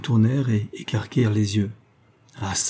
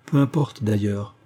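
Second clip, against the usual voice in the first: plain reading voice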